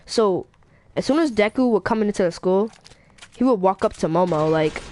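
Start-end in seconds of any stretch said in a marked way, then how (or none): none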